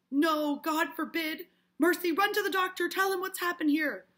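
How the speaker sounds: high, loud, urgent command; frantic orders. Acted. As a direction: send for help in a panic. Frantic orders, acted from play.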